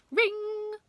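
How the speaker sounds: high, loud, held ringing sound; sung-out bell noise; bell imitation. A narrator's reading. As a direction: ring out like a school bell. Narrator imitates the school bell.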